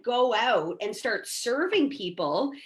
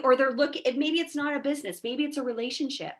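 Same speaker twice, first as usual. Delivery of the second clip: usual speaking voice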